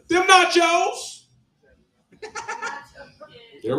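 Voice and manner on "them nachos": high, loud, drawn-out yell; screaming lady. Acted. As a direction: scream it out as a punchline. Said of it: "The lady screams out".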